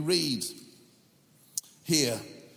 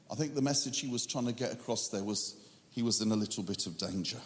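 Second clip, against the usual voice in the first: dry understatement; 8 semitones lower, 5 dB quieter, faster than usual. Real, not acted. Preacher jokes to the congregation, quick deadpan understatement. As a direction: drop the voice for a quick, lower, softer deadpan aside. Dry aside after reading the list.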